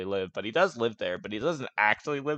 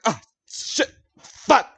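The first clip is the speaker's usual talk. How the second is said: high loud cry of pain; pained yelp; 11 semitones higher, 7 dB louder, much slower than usual. Acted. Colt hit on the roof, yelling in pain. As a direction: yelp after hitting your head. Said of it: "sitting there like".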